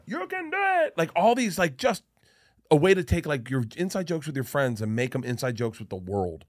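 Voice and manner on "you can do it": high-pitched goofy voice; a high silly Sandler impression; Sandler catchphrase voice. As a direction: squeak it out like a dopey movie character.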